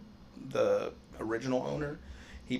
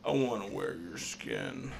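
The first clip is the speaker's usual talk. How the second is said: creepy quote; 5 semitones lower, 3 dB quieter, slower than usual. Retold or imitated. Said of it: "Family Guy".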